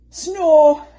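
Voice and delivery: put-on a huge comic snore for the children; loud snore. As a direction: snore noisily. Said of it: "went Missus Bear".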